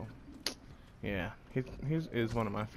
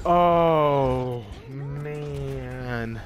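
louder, exasperated oh; loud frustrated groan-word; 2 semitones higher, 7 dB louder than usual. Real, not acted. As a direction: vent after another loss. Another lost round.

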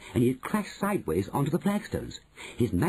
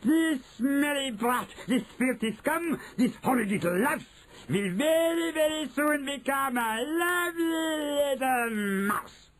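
very high, triumphant witch; gloating witch shouting out. A narrator's reading. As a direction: spit out each insult with glee, then stretch the ending. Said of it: "she shouted out".

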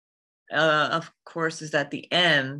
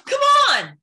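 very high, loud urging shout; exasperated-sounding holler; full-out yell; 8 dB louder than usual. Acted. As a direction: really belt it out high. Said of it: "I have to really be like".

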